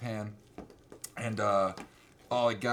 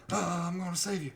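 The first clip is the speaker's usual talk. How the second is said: put-on Southern boy; 6 semitones higher than usual. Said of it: "he's, like, a Southern boy".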